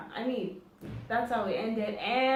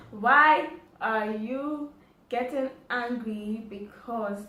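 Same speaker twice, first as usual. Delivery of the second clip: slow, louder exasperated question; 2 semitones higher, 8 dB louder, much slower than usual. Retold or imitated. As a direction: drag it out in disbelief.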